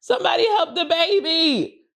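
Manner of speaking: very high, hammed-up wail with laughter; mock weeping alarm. Reacting to seeing a man cry, hammed-up tearful wail. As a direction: wail for help as if about to cry. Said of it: "I would cry".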